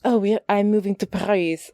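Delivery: accidental mock-French accent for a quoted character. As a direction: put on a French accent, Paris as "Pa-REE". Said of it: "I don't know why I'm doing a French accent".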